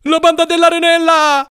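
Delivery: loud, very high-pitched warning yell; alarm cry. A narrator's reading. Paolò warns of the rival gang's attack, high yelled alarm. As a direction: scream the warning to your mates. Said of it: "gridò Paolò".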